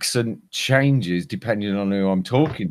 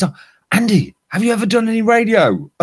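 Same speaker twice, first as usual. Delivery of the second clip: high-pitched, quick imitation of a woman asking; Canadian lady; 13 semitones higher, 4 dB louder, faster than usual. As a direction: imitate her keen question, pitched right up. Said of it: "the Canadian lady I was telling about".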